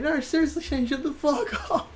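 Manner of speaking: very high, mock fed-up protest; squeaky-high acted exasperation; retold exasperation. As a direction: imitate someone pushed past annoyance. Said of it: "he just did till he got overly annoyed".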